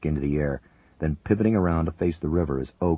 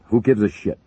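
loud, higher angry bark; 6 semitones higher, 8 dB louder than usual. A narrator's reading. Major barking at the paramedic's warning, angry. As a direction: snap it back. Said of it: "Buchanan barked".

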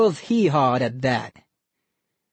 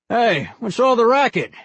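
louder higher annoyed dad; grumpy dad; 8 semitones higher, 4 dB louder than usual. Acted. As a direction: call through the wall annoyed. Father calls from next room.

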